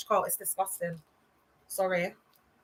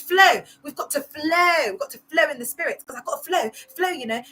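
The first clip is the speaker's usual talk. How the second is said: imitating the Caris church accent, stretching flow; 5 semitones higher than usual. Mocking how Caris members talk, in their church accent. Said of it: "That's how they all sound in Caris".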